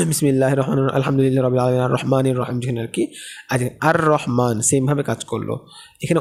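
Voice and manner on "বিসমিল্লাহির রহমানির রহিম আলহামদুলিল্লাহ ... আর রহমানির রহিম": recitation. Acted. Recites Quranic phrase.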